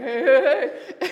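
loud, laughing hey hey; wife imitating husband's loud hello; imitating his voice. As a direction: mimic the booming greeting, laughing. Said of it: "his voice went".

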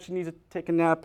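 usual speaking voice

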